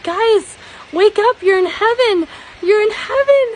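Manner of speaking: put-on high and loud, waking kids with joy; excited calling out. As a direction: call them awake, thrilled.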